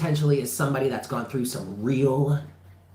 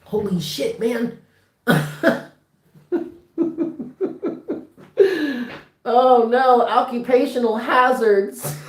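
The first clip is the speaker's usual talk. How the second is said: higher, louder laughing release; emotional laughter; 10 semitones higher, 5 dB louder than usual. Real, not acted. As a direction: laugh it out, overwhelmed and moved. Overcome reading, laughing emotionally.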